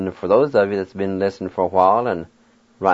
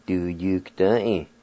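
normal talking voice

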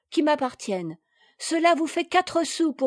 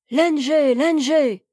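louder call to someone out of sight; calling from the stairs; 3 semitones higher, 6 dB louder than usual. A narrator's reading. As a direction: shout the name up the staircase. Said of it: "cria une voix dans l'escalier".

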